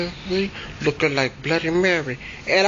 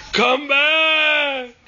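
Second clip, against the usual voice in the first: high, drawn-out calling; crying ghost call; 7 semitones higher than usual. Retold or imitated. Crying ghost calling the fleeing boy back. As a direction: call after him, weeping. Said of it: "She said".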